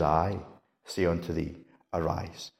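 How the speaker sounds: low, very soft command; quiet authority. Acted. The Lord waking the little girl, quiet authority. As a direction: say it low and hushed, no push. The Lord's calm authority, contrasted.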